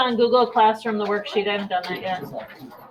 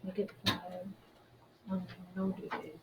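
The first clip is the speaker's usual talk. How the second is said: muttering to herself; 4 semitones lower, 11 dB quieter, much slower than usual. Real, not acted. Muttering while posting online.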